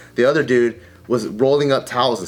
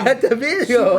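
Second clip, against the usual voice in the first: high, laughing mock Chinese accent; 11 semitones higher than usual. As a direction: echo it in a hammed-up Chinese accent. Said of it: "laughs, echoing the line".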